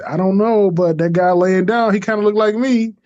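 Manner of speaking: wondering aloud about the man lying there; puzzled reply. Retold or imitated. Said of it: "And I said".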